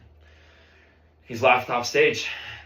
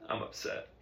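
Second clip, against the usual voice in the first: very quiet, whispery mumble; cowed student's tiny voice; barely audible admission; 12 dB quieter than usual. Acted. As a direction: admit it under your breath. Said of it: "Say it so the whole band can hear you".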